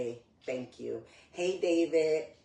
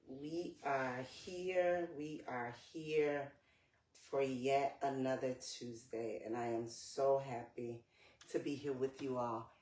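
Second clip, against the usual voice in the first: normal talking voice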